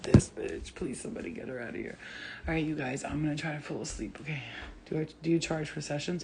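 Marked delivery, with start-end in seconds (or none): 0.0-2.0 fast, hushed whisper; whispered complaint. Retold or imitated. Sleepers whispering to get her out. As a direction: beg under their breath. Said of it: "People like".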